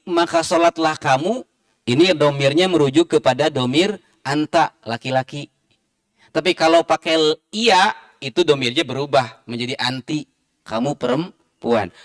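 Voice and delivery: usual speaking voice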